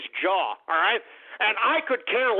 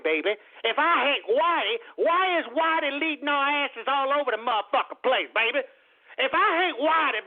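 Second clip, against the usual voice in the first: higher, animated put-on voice with a baby at each line end; mock street voice; 5 semitones higher than usual. Mocking the protesters in a put-on jive voice. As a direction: ham up a jive-talking guy complaining.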